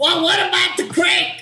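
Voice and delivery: put-on high, affected old Hollywood lady; 1940s movie star lady. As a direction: play a 1940s screen starlet. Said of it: "nineteen forties, lady movie star".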